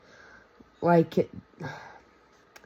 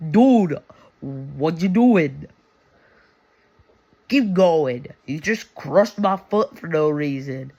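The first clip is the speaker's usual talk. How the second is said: annoyed Balaam; 5 dB louder than usual. Retold or imitated. Balaam scolding his donkey for crushing his foot, louder mock-annoyed yell. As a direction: huff at the donkey like a fed-up rider. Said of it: "whacks the donkey and is like".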